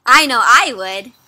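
ordinary delivery

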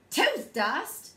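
incredulous half-shouted echo; kinda shouted. A narrator's reading. Child can't believe Dada's tooth dust idea, kinda shouted. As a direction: throw it back as a startled question. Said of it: "I kinda shouted".

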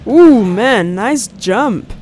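ordinary talk, nothing marked

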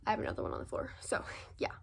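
soft whispered aside; whispering. Real, not acted. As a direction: whisper it off to the side. Whispered aside about scrunchie.

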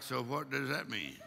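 low, hushed aside; puzzled congregation. Retold or imitated. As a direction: drop down low, voicing baffled listeners. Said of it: "We're like".

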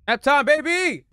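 high, loud hype yell; hyped shout. Real, not acted. Gamer cheering as the game begins, hyped. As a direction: belt it out like a hype man.

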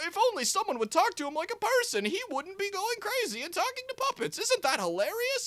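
mock the movie as a gleeful jester; squeaky hammed-up sarcastic narrator voice.

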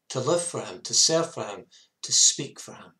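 ordinary talk, nothing marked

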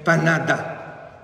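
ordinary talk, nothing marked

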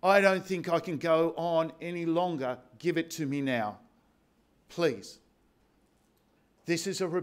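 usual speaking voice

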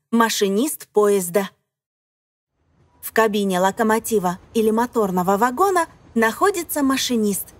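normal talking voice

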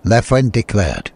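ordinary delivery, nothing marked

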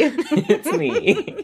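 high, laughing words. Real, not acted. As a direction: giggle through it. Laughing about silly name.

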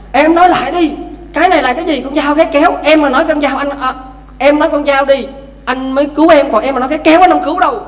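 husband forcing his drowning wife to say knife, in mock anger; mock insistent husband. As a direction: press her hard as a stubborn husband. Husband demands at the water.

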